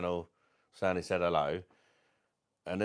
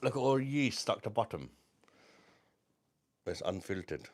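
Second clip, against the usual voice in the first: ordinary talk, nothing marked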